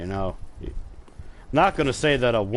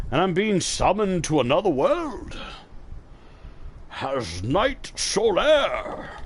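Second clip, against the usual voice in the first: mock grand announcement; 8 semitones higher, slower than usual. Announces summoning dramatically.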